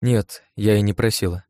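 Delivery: plain reading voice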